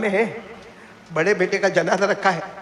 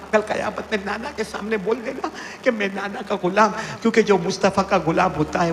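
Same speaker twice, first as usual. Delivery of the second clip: usual speaking voice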